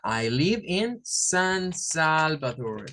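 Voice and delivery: slow, drawn-out English example; slow model sentence. Shown as a demonstration. Teacher giving students a model answer, slowly and clearly. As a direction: say it slowly for learners to copy. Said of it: "yo diría".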